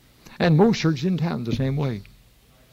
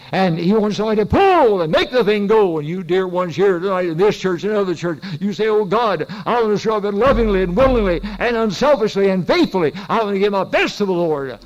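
loud, high, fervent preaching; 7 semitones higher, 6 dB louder than usual. Acted. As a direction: preach it out with full force to the church. Preacher builds to full force.

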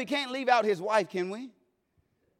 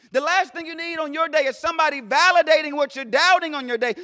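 loud, high, rising preacher's cadence; preaching at full force; 7 semitones higher, 5 dB louder than usual. Acted. Preacher exhorting the congregation, full force. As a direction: build it like a sermon climax.

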